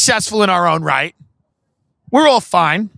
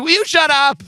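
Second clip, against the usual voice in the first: much higher, quicker mock exasperation; mock outburst; 10 semitones higher than usual. Friend to his mom, mock outburst. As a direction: imitate a high-pitched telling-off. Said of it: "He'd be like".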